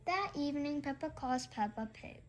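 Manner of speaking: usual speaking voice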